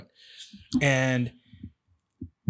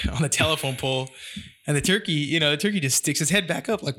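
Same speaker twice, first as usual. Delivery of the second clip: ordinary talk, nothing marked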